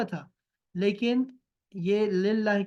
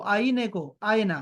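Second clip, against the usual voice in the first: normal talking voice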